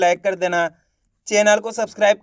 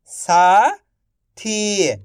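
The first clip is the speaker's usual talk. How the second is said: slow stretched reading; much slower than usual. Shown as a demonstration. Teacher to pupils, slowly stretched. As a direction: draw साथी out slowly. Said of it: "आप इस तरीके से".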